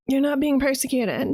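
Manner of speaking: ordinary talk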